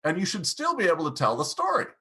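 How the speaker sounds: usual speaking voice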